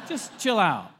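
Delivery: hammed-up irritated brush-off; mock exasperation. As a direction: let it burst out at her. Acting out a dismissive husband.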